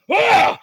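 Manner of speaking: high, loud startled villain yelp. Acted. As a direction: jump back with a shout. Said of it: "Nottingham's like".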